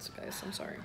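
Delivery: soft unvoiced apology; breathy whisper to the camera; whispered aside. Real, not acted. Aside while blowing balloons.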